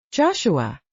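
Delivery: put-on hearty Italian-accented call of the name; lightly voiced jovial Italian host greeting an old friend; thick Italian accent. A narrator's reading. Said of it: "he cried out in a thick Italian accent".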